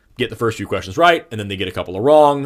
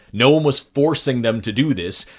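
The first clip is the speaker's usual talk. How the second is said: ordinary talk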